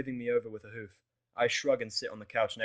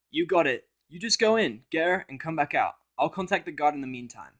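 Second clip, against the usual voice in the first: higher, louder supportive mare; Vinyl's voice; 6 semitones higher, 9 dB louder than usual. Acted. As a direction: cheer him on his way. Vinyl sends him off.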